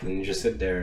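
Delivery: usual speaking voice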